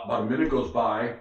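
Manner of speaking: ordinary delivery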